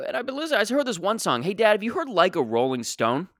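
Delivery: a hint of a high eager kid; lightly voiced younger self. Son asking his father about Like a Rolling Stone, eager. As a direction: ask dad like an excited teen.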